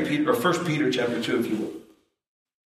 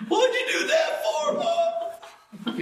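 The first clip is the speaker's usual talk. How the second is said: very high squealing cry; childish wail; slower than usual. Retold or imitated. Boy hit in the face, yelling at his mom in a high wail. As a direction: wail like a hurt twelve-year-old. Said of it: "I started crying".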